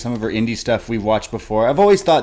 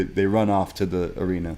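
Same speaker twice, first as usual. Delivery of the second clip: ordinary talk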